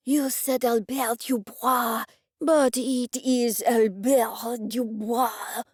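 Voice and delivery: put-on slow, heavy French accent, insisting; flustered French accent. The chef correcting Dennis about his name, flustered French accent.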